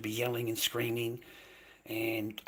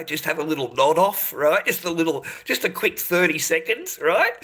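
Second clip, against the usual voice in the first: mocking rise; 5 semitones higher, 8 dB louder than usual. Real, not acted. Mocking nodding off streamers.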